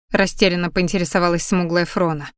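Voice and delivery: ordinary delivery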